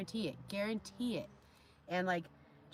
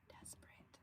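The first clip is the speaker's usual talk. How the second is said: whispered mocking aside; 3 semitones higher, 27 dB quieter than usual. Real, not acted. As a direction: breathe it out, toneless. Mocking aside after rant.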